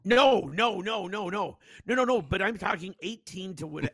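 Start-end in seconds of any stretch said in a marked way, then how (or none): 0.0-2.2 loud, high repeated no's; emphatic denial. Real, not acted. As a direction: wave it off fast and loud. Denying advocating underage drinking.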